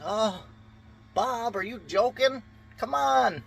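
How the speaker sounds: very high, loud, teasing call to a friend; hammed-up high-pitched nudge to get up; mock buddy teasing. Partygoer teasing buddy Bob in the pool, high mock voice. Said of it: "you're like".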